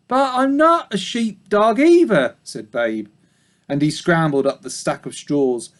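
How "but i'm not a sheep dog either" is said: high little pig voice; young pig. Acted. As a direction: correct her politely as a piglet. Babe's voice.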